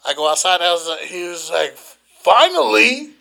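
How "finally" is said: high, loud, exasperated imitation; retold impatient exclamation. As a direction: draw it out as the fed-up customer. Retells impatient waiting customer.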